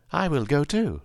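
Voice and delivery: high-pitched character voice; Scarecrow. A narrator's reading. Scarecrow volunteering to join his friends, high-pitched. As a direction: lift it high for a simple straw man. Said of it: "declared the Scarecrow".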